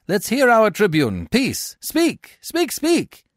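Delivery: high, loud crowd clamour; citizens crying out. A narrator's reading. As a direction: urge the speaker on as a crowd. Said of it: "the citizens cry".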